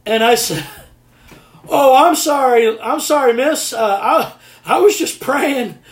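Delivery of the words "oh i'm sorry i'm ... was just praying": higher-pitched, flustered apology; sheepish apology. Retold or imitated. Pastor apologising to startled meter reader, flustered. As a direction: apologise hastily to someone you just scared.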